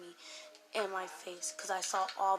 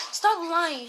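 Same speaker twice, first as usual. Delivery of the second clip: high, loud retold yell; angry shout; 8 semitones higher, 9 dB louder than usual. Girl yelling at Jake for lying, retold angry shout. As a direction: snap it at the lying boyfriend. Girlfriend fight over cheating.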